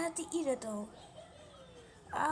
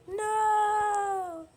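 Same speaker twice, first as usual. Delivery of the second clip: shouting; 5 semitones higher, 9 dB louder than usual. Acted. Dramatic cry of protest.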